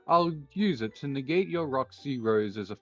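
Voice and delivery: usual speaking voice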